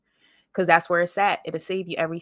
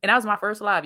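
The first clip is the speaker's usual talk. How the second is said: ordinary talk, nothing marked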